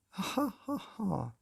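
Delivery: high manic laugh falling away; crazed laughter; unhinged laugh. A narrator's reading. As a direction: laugh like he's gone bananas. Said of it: "a laugh that made you think he'd gone bananas".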